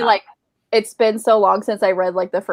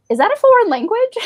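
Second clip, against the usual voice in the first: much higher, louder puzzled husband; 5 dB louder than usual. Retold or imitated. Imitating her husband hearing fast audiobooks, high and puzzled. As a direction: play the baffled husband, pitched way up. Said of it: "He's always like".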